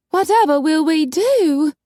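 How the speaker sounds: high, wailing helplessness; melodramatic, pitched well up; despairing lady. A narrator's reading. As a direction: lament in a high fluttery voice. Coralie despairs.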